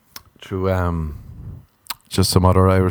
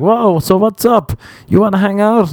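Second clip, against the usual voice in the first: mock eager texter; 11 semitones higher than usual.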